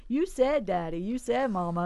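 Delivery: put-on high insistent kid voice; whiny little kid reminding a parent; child pleading. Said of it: "our kids do that".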